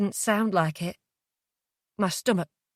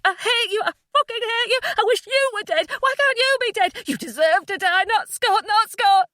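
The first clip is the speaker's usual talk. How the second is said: high-pitched torrent of grief and rage; furious, grief-stricken screaming; hysterical screaming; 13 semitones higher, 4 dB louder than usual. A narrator's reading. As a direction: scream it all out at him. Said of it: "I screamed".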